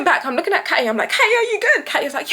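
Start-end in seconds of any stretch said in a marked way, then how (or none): 1.1-1.9 high, raised mock call; urgent checking call. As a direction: call out to check on a friend mid-chase. Calls to friend while running.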